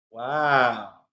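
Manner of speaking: plain reading voice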